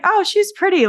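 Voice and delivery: high, gushing mock admiration; smitten friend. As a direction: play the friend who is instantly charmed, lightly. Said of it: "he was like".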